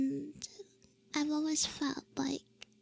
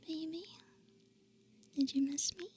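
usual speaking voice

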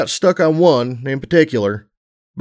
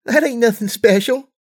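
giggling; 11 semitones higher than usual. A narrator's reading. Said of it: "she giggled".

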